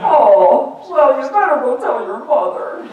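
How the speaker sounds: high, put-on dad voice; dad's reaction. Dad answering his son's coming out, imitated in a high voice. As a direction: imitate a flustered dad, pitched well up. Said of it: "he said".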